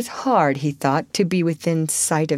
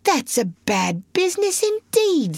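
lightly voiced driver, thoughtful and higher; reflectively; 11 semitones higher than usual. A narrator's reading. Driver pondering the washerwoman's trouble, a touch reflective. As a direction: muse on it kindly. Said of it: "said the engine driver reflectively".

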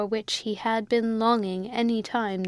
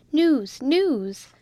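higher-pitched, eager announcement; excited cry; 5 semitones higher, 4 dB louder than usual. A narrator's reading. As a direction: call out the big news. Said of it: "cried the emissary of Major Dobbin".

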